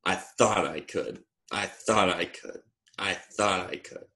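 quiet, settled storybook mantra; soft chugging refrain. A narrator's reading. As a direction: fade gently with each repeat. Engine puffs down repeating, satisfied.

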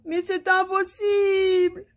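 very high, slow, drawn-out cry; despairing wail. A narrator's reading. King thinks happiness is hopeless, wailing.

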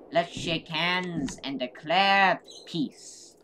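slow, ceremonial declaration; solemn and slow. Acted. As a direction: declare it slowly and grandly.

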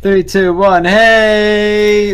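big cheery shout of hey; loud greeting. Real, not acted. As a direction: burst in with a loud hello. Stream countdown greeting.

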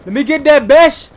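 excited gamer shout at the screen; hyped shout. Real, not acted. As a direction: yell it again, pumped up. Hyped gameplay, repeated louder.

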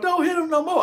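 high-pitched mock plea; imitated pleading girlfriend.

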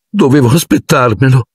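tragica, più alta, un accenno di lamento; tono tragico. A narrator's reading. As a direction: Lavanda si compiange, melodrammatica. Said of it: "in tono tragico". (Lavanda tragica per il coniglio, lamentosa)

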